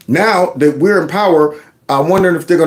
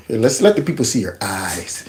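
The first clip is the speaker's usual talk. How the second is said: whisper; 5 semitones lower, 5 dB quieter than usual. Real, not acted. Whispering to the puppy.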